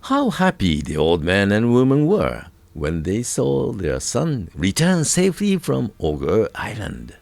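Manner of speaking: ordinary delivery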